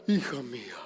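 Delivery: very low, hushed fatherly God voice; mock voice of God. Preacher showing how God won't talk to you, mock deep God voice. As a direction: attempt a deep, soft voice of God. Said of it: "Dios no te va a hablar".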